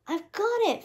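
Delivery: high, eager announcement of success; lightly voiced triumphant fairy; triumphant declaration. A narrator's reading. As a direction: proclaim it like a fairy who just won. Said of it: "Fauna declared".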